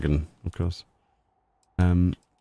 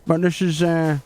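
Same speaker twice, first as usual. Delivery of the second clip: higher, bright-eyed 'hi guys' YouTuber hype; hammed-up YouTuber intro voice, pitched up; parody YouTuber voice; 5 semitones higher than usual. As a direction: play an over-eager clickbait YouTuber, guys after every phrase. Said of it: "We'll make our own Easter egg video then".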